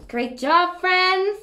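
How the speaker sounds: ordinary talk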